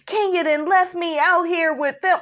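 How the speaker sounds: high, put-on whiny griping; bitching and moaning. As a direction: imitate a moaning complainer. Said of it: "She's bitching and moaning".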